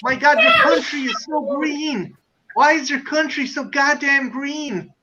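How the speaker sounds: marvelling mock-American tourist; American tourist accent. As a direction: gush and gripe in an American accent. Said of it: "the worst is American tourists".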